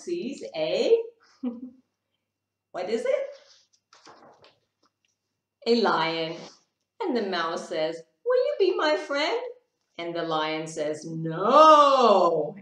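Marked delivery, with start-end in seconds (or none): 2.7-3.3 laughing, high and fast; playful quick lift; excited high question. Real, not acted. Playful question while reading. 8.2-9.4 small high pleading voice; a tiny mouse asking hopefully; squeaky little plea. Acted. Mouse asks the lion. 11.0-12.6 loud roaring no; a lion growling a refusal; booming lion voice. Acted.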